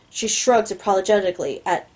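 ordinary delivery

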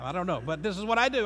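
ordinary talk

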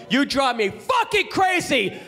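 high, drawn-out angry yell; exasperated acted outburst; furious mock shout. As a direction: yell it slowly, climbing in pitch. Acted fight, hitting the wall.

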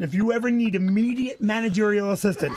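ordinary talk

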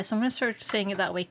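usual speaking voice

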